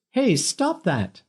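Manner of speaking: high, slow woman's cry. A narrator's reading. Dinah shouting at Rob kicking Peter, angry high cry. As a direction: call out to stop the attack. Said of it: "a woman's voice raised in anger".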